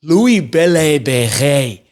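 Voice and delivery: put-on slow, drawn-out French accent on the name; playful French accent on a player's name. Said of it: "I've said that with the best French accent that I have".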